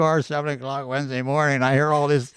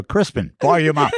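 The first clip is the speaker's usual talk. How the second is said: louder, like commanding a smart speaker; raised-volume command to a person; mock voice command; 3 semitones higher, 6 dB louder than usual. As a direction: call it out as a joking order. Mimics voice command to quiet man.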